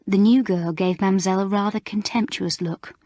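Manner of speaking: plain reading voice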